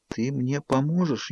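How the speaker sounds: slow, soft, squeezed-out question; barely moving lips. A narrator's reading. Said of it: "Еле двигая губами, выдавила я".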